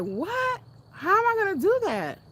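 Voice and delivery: high, loud shocked disbelief; shocked panic. Retold or imitated. Daughter answering her father's demand, shocked. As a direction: cry out in alarmed disbelief. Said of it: "And I was like".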